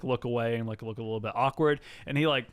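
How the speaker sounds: usual speaking voice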